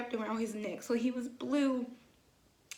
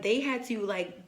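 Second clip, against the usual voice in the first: ordinary delivery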